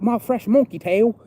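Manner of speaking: imitating a friend's New Zealand accent, high and loud. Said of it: "It's a New Zealand accent".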